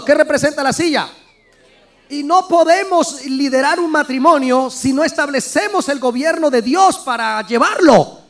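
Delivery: normal talking voice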